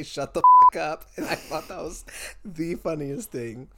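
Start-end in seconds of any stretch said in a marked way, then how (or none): 0.0-1.0 loud, higher burst; mock shout. Host teasing co-host over being offended, mock shout. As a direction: yell it as a quoted comeback. Requoting own earlier yelled command.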